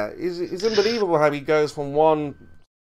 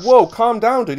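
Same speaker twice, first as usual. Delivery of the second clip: higher, louder mock plea to calm down; 6 semitones higher, 4 dB louder, slower than usual. Telling friend to calm down, mock alarmed. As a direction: hold your hands up, half-laughing. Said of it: "I'm like".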